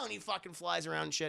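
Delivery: ordinary delivery, nothing marked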